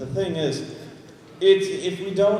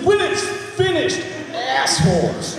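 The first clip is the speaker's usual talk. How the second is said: mock yell; 6 semitones higher, slower than usual. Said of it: "It's like".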